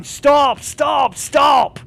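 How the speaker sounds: high, loud repeated yells of stop; shouting stop. Retold or imitated. Retelling how he yelled at a player to stop shooting, loud shouting. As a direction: yell at him to cease fire. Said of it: "I'm going".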